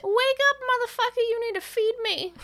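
high-pitched pestering pet; demanding cat voice. Pretend. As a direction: put on a high voice for a hungry, bossy cat. Voicing cat demanding food.

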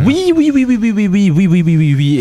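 escalating oui. Retold or imitated. Escalating yes, imitating song.

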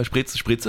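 spritz said the German way, as shpritz with a hard ts; German pronunciation. Shown as a demonstration. Demonstrating the Austrian way to say the cocktail name, spritz as shpritz. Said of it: "la prononciation, euh, vous comprendrez".

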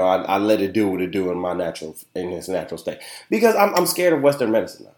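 ordinary delivery

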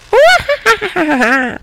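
put-on high cackling laugh of an old crone; evil high-pitched cackle; witch cackle. A narrator's reading. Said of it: "lâcha la corde en ricanant".

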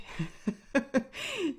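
high, amused burst of laughter; real laugh. Real, not acted. Podcaster confessing she felt clumsy at first, laughing.

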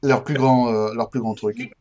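talking just after a sip. Real, not acted. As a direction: voice wet from drinking. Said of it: "excusez-moi, je bois".

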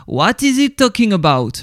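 a mock English line in a French speaker's accent; playful puzzled question in put-on English. Host jokes to listener, puzzled, in a self-mocking English accent. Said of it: "mon anglais est toujours là".

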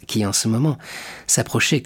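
ordinary delivery

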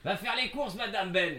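high, soft bossy command; bossy order. Retold or imitated. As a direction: order her to go shopping. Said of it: "je dis".

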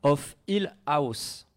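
slow, careful English pronunciation from a French speaker; French speaker's slow, loud English accent. Real, not acted. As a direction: say the English title slowly in an English accent. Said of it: "Désolé pour mon accent anglais".

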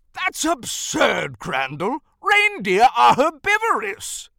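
loud, indignant scoff with swooping pitch; incredulous Santa. Acted. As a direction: draw out the disbelief, then exclaim.

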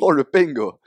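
high, loud "pengo" with a heavy ng; added southern G. Shown as a demonstration. Southerner hamming up the added G, "pengo" with hard ng. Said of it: "ça rajoute un G après".

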